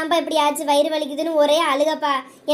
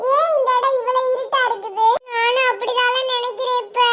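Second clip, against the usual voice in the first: put-on higher-pitched voice of a wondering child; puzzled child; 6 semitones higher than usual. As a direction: play a small child puzzling over where everyone went. (குழந்தைக் குரலில் குழப்பத்துடன்)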